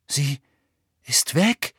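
halting, rising question; horrified disbelief. A narrator's reading. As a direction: pause after the first word, then climb in dismay. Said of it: "sagte Harry entsetzt".

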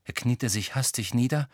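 ordinary delivery

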